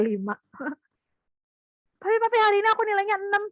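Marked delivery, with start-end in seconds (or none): 2.0-3.4 put-on high, fast eager kid voice; a child bursting with news; excited child. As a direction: rush it out like a proud child. Child reports six to father.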